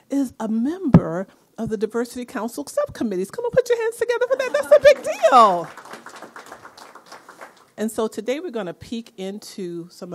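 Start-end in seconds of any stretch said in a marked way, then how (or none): 3.3-5.7 very high, excited cheer to the crowd; rousing applause call. Real, not acted. Host urging the room to put hands together, rousing cheer.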